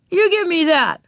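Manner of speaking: put-on high, indignant boy's demand; angry demand. A narrator's reading. Wayne grabs for his lollipop, angrily demanding. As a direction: demand it back at once.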